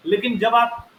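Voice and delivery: usual speaking voice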